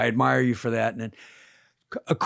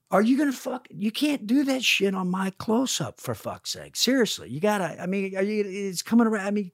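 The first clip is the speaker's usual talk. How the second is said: mock exasperated plea; 6 semitones higher than usual. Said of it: "I literally have to say to him".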